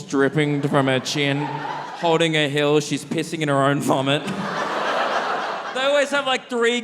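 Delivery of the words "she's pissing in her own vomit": ordinary talk, nothing marked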